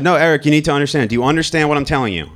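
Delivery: a dad pushing his son to get it; insistent father. Retold or imitated. Father pressing his teenage son.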